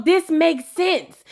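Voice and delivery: ordinary talk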